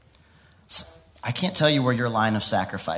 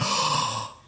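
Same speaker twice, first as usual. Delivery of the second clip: big breathy intake of wonder; acted astonishment; awed gasp; 5 dB quieter than usual. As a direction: gasp as if suddenly given good news. Acts out receiving a word, gasping.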